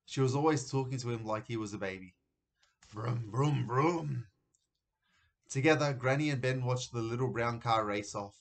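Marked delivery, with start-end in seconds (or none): 2.9-4.3 car revving noise, rising on the last; imitated engine revs. A narrator's reading. Narrator mimics parents' car racing off, revving noise. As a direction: voice a little car revving away.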